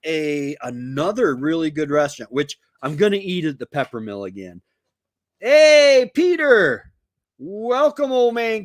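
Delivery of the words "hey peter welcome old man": high, loud greeting shout; cheerful loud welcome; calling out. Real, not acted. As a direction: hail a friend who just walked in. Greeting a viewer arriving in chat.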